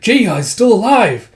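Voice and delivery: high loud excited call. Retold or imitated. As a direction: burst out with relief. Relieved shout in battle.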